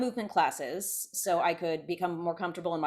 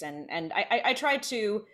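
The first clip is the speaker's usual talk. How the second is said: usual speaking voice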